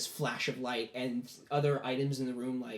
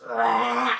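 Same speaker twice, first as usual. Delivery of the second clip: loud, drawn-out roar; demon roar; 3 semitones lower, 8 dB louder than usual. Acted. Five-horned demon rising out of the circle, loud roar. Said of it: "huge demonic-like form".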